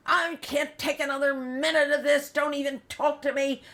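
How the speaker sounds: loud, high angry outburst; imitating a mother at breaking point; retold furious tirade. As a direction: spew it at them at full volume. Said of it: "spiels out at him like you wouldn't believe".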